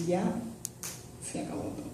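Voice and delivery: whispered, barely there, all hope gone; hopeless whisper. Retold or imitated. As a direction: breathe it out as if everything is lost. Despair at police cars. (susurro sin esperanza)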